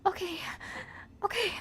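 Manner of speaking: high, trembling okays; shaky, scared reply. Acted. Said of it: "she says in a trembling voice".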